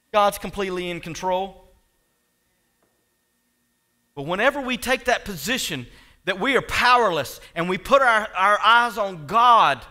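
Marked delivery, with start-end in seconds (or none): 4.2-9.8 loud, raised pulpit delivery; forceful preaching. Real, not acted. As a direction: preach it with conviction.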